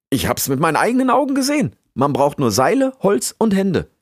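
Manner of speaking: high, loud, quick assurance; excited insisting. Acted. Rix assures the beaver he saw it himself, excited.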